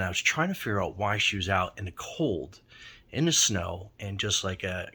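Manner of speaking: usual speaking voice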